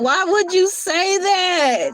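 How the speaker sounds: high, slow pleading scold; hammed-up hurt question; mock reproach. Playful scolding for the animal.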